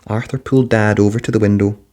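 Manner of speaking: plain reading voice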